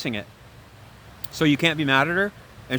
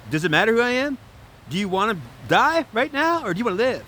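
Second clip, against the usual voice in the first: high-pitched impatient challenge; exasperated rescuer; 8 semitones higher than usual. Acted. Rescuer to Aries dangling off cliff, exasperated. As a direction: press them urgently, incredulous at the delay.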